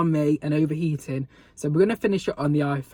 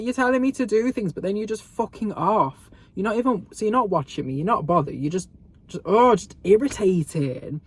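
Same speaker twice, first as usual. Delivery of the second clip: raised, louder irritated rant; acted-out exasperation; 6 semitones higher, 5 dB louder than usual. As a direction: tell off the boss in mounting frustration. Acted complaint about the manager.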